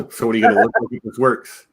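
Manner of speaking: quick, lightly voiced movie quote; quoting the character. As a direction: toss off the character's line, fast. Quoting a film character's line.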